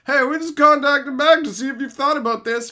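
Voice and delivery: high, loud mock-cheery marketer voice. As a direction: parody a pushy marketer. Mocking marketer emails.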